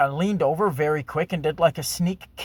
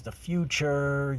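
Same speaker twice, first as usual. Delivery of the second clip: ordinary talk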